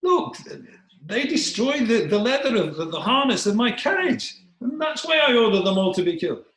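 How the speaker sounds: very high, loud royal outburst; irate king. Acted. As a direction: justify yourself at full pitch, exasperated. King justifies his order.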